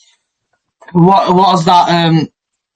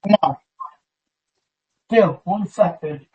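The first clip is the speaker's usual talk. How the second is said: faint, far-off headset audio; headphones used as mic; 7 dB quieter, slower than usual. Real, not acted. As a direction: sounds like the back of a room. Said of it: "Sound like you're in back of a room somewhere".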